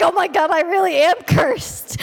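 horrified cry. Retold or imitated. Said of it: "I was like".

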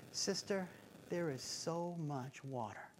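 a quiet calm voice for the panicked shopper; calm soothing. Retold or imitated. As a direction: settle her panic gently. Calm lady soothes panicked woman.

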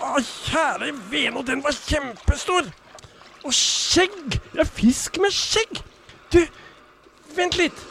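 high, astonished exclaiming; amazed. Acted. Fisherman amazed by a bearded fish, high astonished cries. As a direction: marvel at the huge bearded fish.

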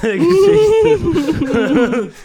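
slow, loud, high, laughing proclamation; mock grandiose, laughing.

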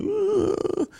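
imitating the sick boy's high groan; feverish groan. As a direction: groan like a boy burning up with fever. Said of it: "He's just like".

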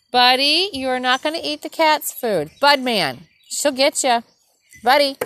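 high, loud warning to a pet; stopping Buddy eating the cat's food, high loud scold; scolding the dog. Real, not acted. Scolding dog over cat food.

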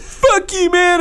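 very high-pitched indignant comeback; mock outraged retort. Bar owner answering the TV expert, mock outrage. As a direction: imitate an insulted owner snapping back. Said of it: "And he's like".

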